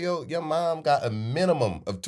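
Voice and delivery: cracking up while hyping her; laughing, high-pitched admiration. Retold or imitated. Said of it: "I said".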